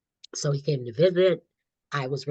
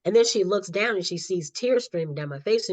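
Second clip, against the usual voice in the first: ordinary talk, nothing marked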